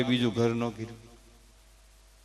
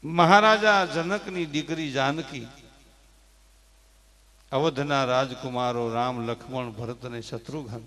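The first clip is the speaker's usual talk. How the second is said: normal talking voice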